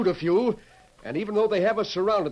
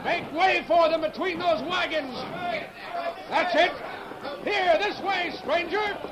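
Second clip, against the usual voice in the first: calling out; 12 semitones higher than usual. Acted. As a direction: wave them in with your voice. Guiding riders in during battle.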